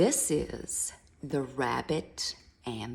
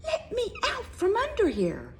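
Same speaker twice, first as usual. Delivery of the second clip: pleading mouse; 4 dB louder than usual. Acted. Mouse trapped under lion's paw.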